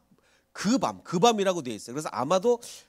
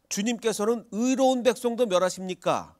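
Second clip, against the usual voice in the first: raised indignant quote; 7 semitones higher, 4 dB louder than usual. Retold or imitated. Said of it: "아비멜렉이 뭐라고 얘기하죠".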